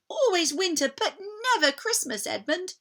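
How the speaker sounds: a hint of a girl horrified by the witch; Lucy. A narrator's reading. Lucy telling Edmund about the witch's winter, lightly voiced.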